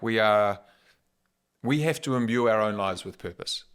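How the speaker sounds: ordinary talk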